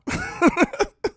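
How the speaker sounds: high-pitched giggling fit; real laughter. Real, not acted. Host cracking up over that baby, real laughter.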